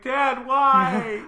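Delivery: high pleading whine; whiny son. Retold or imitated. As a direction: whine up at dad.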